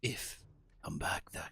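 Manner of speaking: slow, drawn-out words with long pauses; mockingly gracious villain, sinking into a hush; condescending villain.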